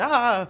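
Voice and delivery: high, loud yelp of alarm; sudden loud "ah" of mock panic; mock panicked scream. Student hearing teacher attempt their name, mock scream.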